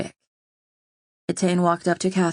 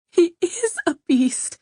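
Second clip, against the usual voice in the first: much higher, slow, strained hush; queen insisting, desperately hushed; desperate whisper; 12 semitones higher than usual. A narrator's reading. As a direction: spit it out in despair. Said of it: "Catherine whispered desperately".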